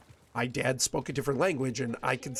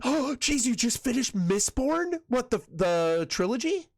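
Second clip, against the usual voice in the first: surprised gasp; 9 semitones higher than usual. Real, not acted. Fan hears friend finished Mistborn.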